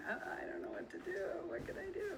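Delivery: breathless, fast, panicked whisper jumping up high; mock panic, rushed and breathy; panicky freaking out. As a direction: gasp it out like a deer in the headlights. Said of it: "freaking out and going".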